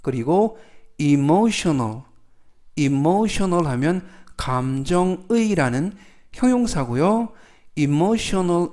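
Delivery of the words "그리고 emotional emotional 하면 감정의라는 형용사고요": ordinary talk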